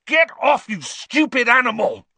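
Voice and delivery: high, loud yell at an animal; furious, high-pitched scolding; angry shout. A narrator's reading. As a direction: shout it off in outrage. Ron fights the clawing cat.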